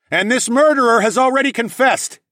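high, loud accusing announcement; loud proclamation. A narrator's reading. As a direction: proclaim the guilt. Hero addresses crowd.

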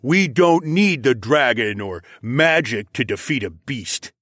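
slow, emphatic alpha voice; lightly voiced proud pack leader; boastful alpha. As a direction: cut in with slow bravado. Alpha dismissing outside help.

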